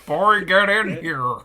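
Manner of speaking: drawn-out cartoon dad impression; Homer in season one. Host mocking the actress as cartoon dad, impression. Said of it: "sounds like Homer in season".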